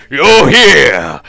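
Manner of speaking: loud, high booming king's welcome; boisterous drunk king roars a welcome; booming greeting. Acted.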